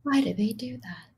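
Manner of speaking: breathy whispered lament. Real, not acted. Cohost reacting to the ghosting story, whispered. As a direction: whisper it in mock despair.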